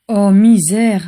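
a touch of theatrical despair; moaning. A narrator's reading. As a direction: lament it with a mild moan. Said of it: "gémit Andromeda".

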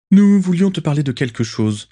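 plus aigu et plus fort; Rosier engageant. Acted. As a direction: amorcer la confidence. Rosier aborde le sujet. (Rosier aborde Lucius, plus aigu et plus fort)

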